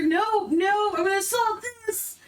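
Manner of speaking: high, fast hammy outburst; dramatic soliloquy. Pretend. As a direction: overact the declaration. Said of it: "I soliloquy".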